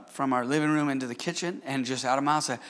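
ordinary delivery

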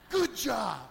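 loud, cheering good job; hearty praise. Retold or imitated. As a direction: shout praise at your son. Father praising son on phone.

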